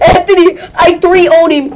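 ordinary talk, nothing marked

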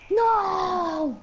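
long, loud wail of "no", falling away; dismayed cry. Acted. Player wailing as the game goes wrong, dismayed cry.

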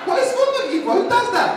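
very high, loud comic outrage; indignant rant. Acted. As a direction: squeal your outrage at a clueless mate. Ranting at friend who answered.

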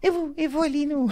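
high reedy falsetto with a laugh in it; thin high voice. Retold or imitated. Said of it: "ele fez uma voz fina".

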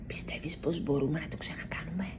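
hushed, breathy secret question; a mouse whispering in bed. A narrator's reading. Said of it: "ψιθυρίζει".

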